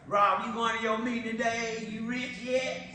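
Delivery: mock him like laughing coworkers. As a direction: coworkers mocking Rob, loud and high. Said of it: "everybody used to laugh at me".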